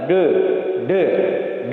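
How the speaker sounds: D said with the tongue curled back; Balinese-style retroflex D. Shown as a demonstration. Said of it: "Bali beda lagi, agak ke dalam".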